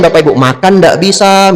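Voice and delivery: ordinary talk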